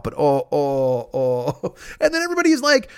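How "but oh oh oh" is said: slow, lower hammed-up groans; fake sick moaning. Telling his chat he won't throw up, slow mock groans. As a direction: stretch out the oh like a dying man. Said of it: "I kept saying".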